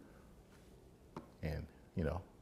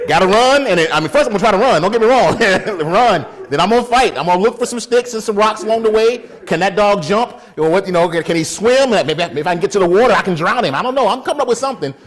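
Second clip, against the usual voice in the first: high, loud scrambling panic; mock frantic; 10 semitones higher, 12 dB louder than usual. Man planning escape from the dog, mock frantic. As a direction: think out loud in a hammed-up panic.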